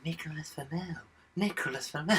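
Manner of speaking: hushed, careful mimicry of a film line; Daniel Radcliffe imitation. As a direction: softly parody the young actor's slow delivery. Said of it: "so slow in that fucking voice".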